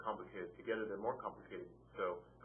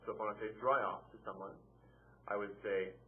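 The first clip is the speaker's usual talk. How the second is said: ordinary talk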